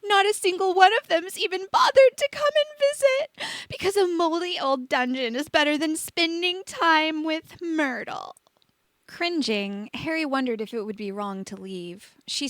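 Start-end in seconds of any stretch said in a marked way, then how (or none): 0.0-8.4 much higher, louder, sobbing ghost wail; howling sob. A narrator's reading. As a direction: wail it out in self-pity. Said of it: "She started to howl and sob".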